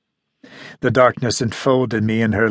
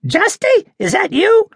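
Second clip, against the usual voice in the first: high, loud squeaky woman's call; woman at the door calling out, high and squeaky; 4 dB louder than usual. A narrator's reading. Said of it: "a woman's squeaky voice asked".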